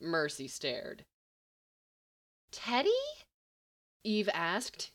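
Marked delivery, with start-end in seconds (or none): 2.5-2.9 incredulous. A narrator's reading.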